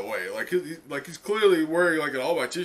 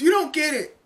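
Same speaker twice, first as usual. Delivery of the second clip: put-on much higher earnest girl's voice; Uraraka pleading; 7 semitones higher than usual. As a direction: plead with them from the heart. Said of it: "And Uraraka goes".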